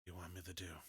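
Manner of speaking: very soft, secretive murmur; hushed. Acted. Conspirator answering a companion's secret question, hushed. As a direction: answer under your breath.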